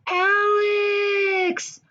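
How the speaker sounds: very high, excited squeal of a name; surprised shriek. Real, not acted. Gasping at a fan name.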